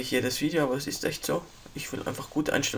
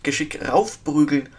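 ordinary delivery

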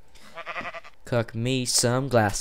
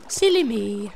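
silly voice; 12 semitones higher, 5 dB louder than usual. Pretend. Mocks himself in a funny voice.

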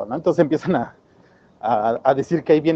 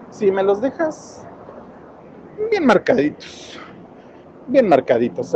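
relishing villain; 5 semitones higher than usual. Retold or imitated. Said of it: "Así lo dice hasta rico".

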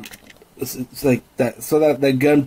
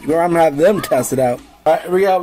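usual speaking voice

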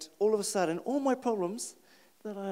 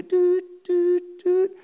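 high, loud, steady beeping sounds; imitated machine beeps; 12 semitones higher, 6 dB louder, much slower than usual. Man in hospital bed, imitated high beeping. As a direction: beep like a machine on one high note.

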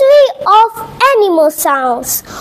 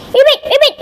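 high imitated frog call; joyful frog ribbit; 9 semitones higher, much faster than usual. Frog hopping with joy, high ribbits. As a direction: ribbit twice, high and quick. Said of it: "Fay the frog hopped with joy".

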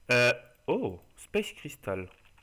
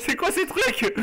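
surprised; slower than usual. Real, not acted. Startled by strange creatures.

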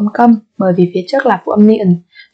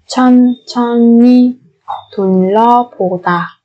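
syllable by syllable. Shown as a demonstration. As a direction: draw out each syllable of 천천히 둘러보다. Slow syllable-by-syllable model. (đọc 천천히 둘러보다 chậm, tách từng âm)

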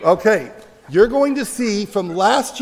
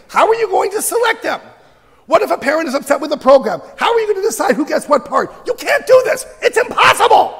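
mock outraged yell, high and loud; 8 semitones higher, 7 dB louder than usual. Everybody yelling at the teacher who proposed Shakespeare, high indignant shouting. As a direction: fire off high, loud objections, acting the yellers. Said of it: "Everybody yelled at me".

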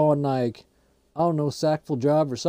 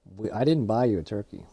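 ordinary delivery

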